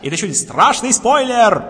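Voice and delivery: loud, high mock warning; excited. Real, not acted. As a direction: shout the spoiler warning.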